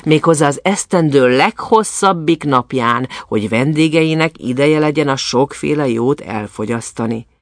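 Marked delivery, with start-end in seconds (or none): none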